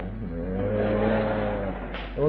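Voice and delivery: ordinary talk, nothing marked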